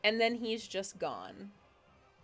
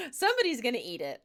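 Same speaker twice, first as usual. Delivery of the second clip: laughing prediction; 4 semitones higher, 5 dB louder, much faster than usual. Real, not acted. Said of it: "I just know it".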